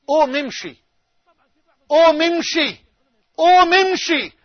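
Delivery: commanding summons swelling to a shout; calling voice. Retold or imitated. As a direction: build the call until it rings out. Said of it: "بينادي علي بصوت عالي جدًا".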